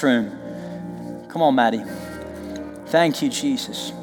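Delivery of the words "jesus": usual speaking voice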